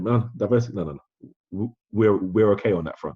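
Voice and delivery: mock a company cutting the caller off; dismissive cut-off. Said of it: "they cut you off".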